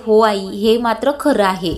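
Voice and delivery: plain reading voice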